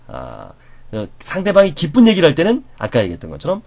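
ordinary talk

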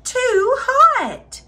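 high-pitched pained exclamation; crying out. A narrator's reading. Aluki after sipping the biggest bowl, crying out. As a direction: exclaim high, as if the tongue is burning. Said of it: "she cried out".

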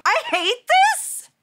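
high, loud and slow, dragged out in frustration; fed-up outburst; frustrated yell. Real, not acted. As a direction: shout it at the screen. Reacting to fight going badly.

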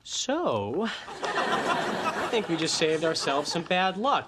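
usual speaking voice

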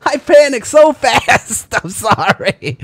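high, loud, slow laughing wail; laughing apology. Real, not acted. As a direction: wail it slowly through laughter. Laughing apology after panic.

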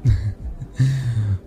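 brief amused chuckle; soft chuckle. Acted. Amused flirting.

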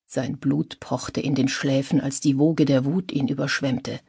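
ordinary delivery, nothing marked

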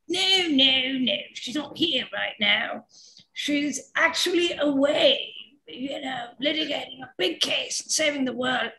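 put-on fob off the caller in a British accent; a hint of a British secretary voice. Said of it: "so now I have a British voice".

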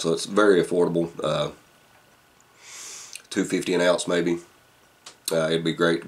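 talking around pipe stem; clenched teeth on pipe; pipe in mouth. Real, not acted. Seen 1: Pipe stem at lips through most frames; removed by 18.1.

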